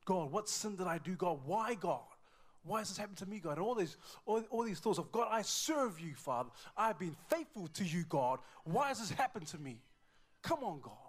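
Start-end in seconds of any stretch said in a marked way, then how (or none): 0.1-3.6 raised, louder cry to God; acted-out anguished questioning; anguished plea. 5.1-10.9 high, loud pleading with God; acted-out desperate lament; anguished protest. Faithful father protesting to God.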